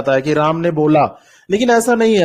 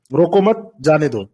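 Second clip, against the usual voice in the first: "रोको मत" as one group, then "जाने दो" falling lower; "रोको मत, जाने दो" split after "मत"; comma after मत; 2 semitones lower than usual. Shown as a demonstration. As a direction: keep "मत" with "रोको", then let "जाने दो" drop. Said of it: "comma इधर से उधर हुआ".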